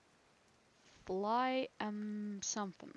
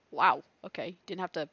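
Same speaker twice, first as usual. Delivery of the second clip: ordinary talk, nothing marked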